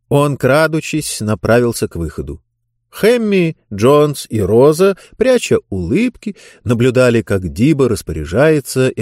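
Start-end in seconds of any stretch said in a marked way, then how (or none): none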